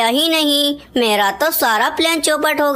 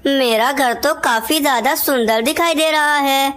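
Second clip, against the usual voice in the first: ordinary delivery, nothing marked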